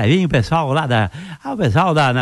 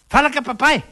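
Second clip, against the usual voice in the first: commanding the dog; 8 semitones higher than usual. Acted.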